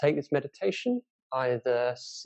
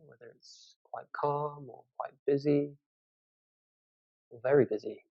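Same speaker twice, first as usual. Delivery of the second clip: guided meditation. Acted. Teacher leads listeners through a body scan from the toes, guided meditation. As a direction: guide them unhurriedly through a body scan, leaving space between phrases.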